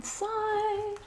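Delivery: high, lilting sing-song. Real, not acted. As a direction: lilt it up high, like a little tune. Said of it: "I have to do my sing-song voice".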